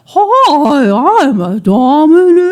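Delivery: loud, high, swooping whale call; whale furry dominatrix. Acted. Introducing a joke character, whale dominatrix voice. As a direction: moan it out like a whale singing. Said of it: "gonna be the whale furry dominatrix".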